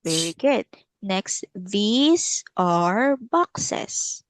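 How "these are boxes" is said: very slow, stretched words with rising tones; teacher's drawn-out repeat-after-me delivery; slow model sentence. Shown as a demonstration. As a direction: stretch each word for a learner.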